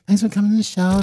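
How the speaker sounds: imitating Michael's high, light thanks. Michael thanking everyone for coming, in a high voice. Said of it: "we're back to the high voice".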